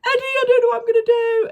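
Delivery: loud, high mock wail; squealing retold despair; imitated crying. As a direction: wail it to brother, hammed-up. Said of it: "I'm just at the house crying".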